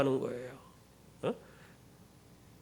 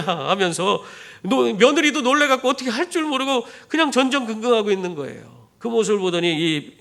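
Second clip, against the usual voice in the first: ordinary talk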